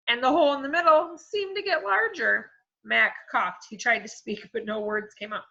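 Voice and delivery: ordinary delivery, nothing marked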